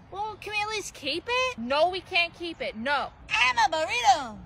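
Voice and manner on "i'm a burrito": higher odd little creature croak; creepy creature voice. Acted. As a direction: speak like a weird tiny critter. Strange creature speaks.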